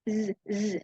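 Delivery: "Z" as a voiced buzzing zz. Shown as a demonstration. Teacher showing students the z sound, buzzing. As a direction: hold "Z" as a voiced buzz. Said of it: "And then".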